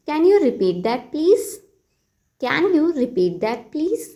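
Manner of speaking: very slow, teacherly model phrase; slow model sentence. Shown as a demonstration. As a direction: spell it out slowly for learners. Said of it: "तो इस बात को आप English में कहिए". (बहुत धीमे, सिखाने वाले अंदाज़ में)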